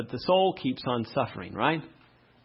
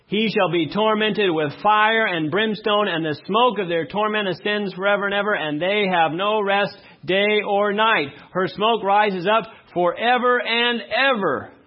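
preaching; 5 semitones higher, 3 dB louder than usual. Acted. Preacher solemnly reading Revelation to the congregation.

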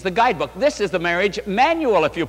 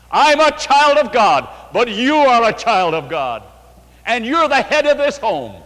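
bold declaration; 5 semitones higher, 6 dB louder than usual. Pretend. Confident wife's declaration.

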